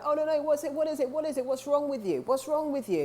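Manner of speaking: imitating a nosy, pushy person; pushy prying questions. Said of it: "If they're like".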